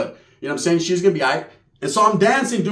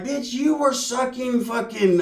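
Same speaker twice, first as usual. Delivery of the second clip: slow, drawn-out, higher shout; mock angry outburst; 6 semitones higher, slower than usual. Cousin snapping back at Sheila, mock angry shout. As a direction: lash back at her.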